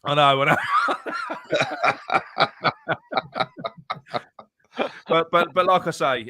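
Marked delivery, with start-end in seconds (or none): 0.6-4.2 long, high cackle of laughter; helpless high laughing fit; high-pitched laugh. Real, not acted. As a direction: crack up laughing at length. Amused by teammate's teasing.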